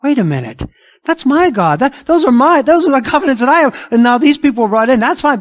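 offended insider claiming God as his own; mock jealous objection. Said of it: "some of them are gonna say".

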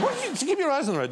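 high, fast alarmed question; terrified passenger, high and fast; panicked friend. Retold or imitated. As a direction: shriek it in disbelief from the passenger seat. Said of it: "and they go".

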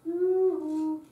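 high yoo-hoo copied back; imitating the child answering; child's echo. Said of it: "and they go".

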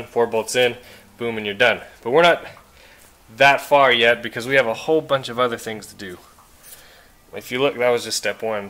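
usual speaking voice

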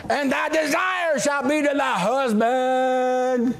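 high, drawn-out triumphant reading; gleeful proclamation. Retold or imitated. Preacher reading his favourite part to wives, gleefully proclaiming. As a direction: stretch the verse out with relish.

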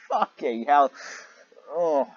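loud, exasperated curse; exasperated outburst. Real, not acted. Reader cursing loudly at the fanfic. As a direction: blurt it out in disbelief.

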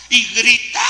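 sudden loud burst; loud exclamation. Real, not acted. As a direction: hit it hard. Telling of a possessed youth.

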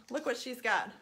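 plain reading voice

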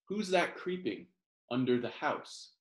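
ordinary delivery, nothing marked

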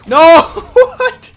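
loud scream breaking into laughter; shriek, then giggle in disbelief. Real, not acted. Beamed by enemy player.

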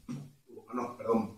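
normal talking voice

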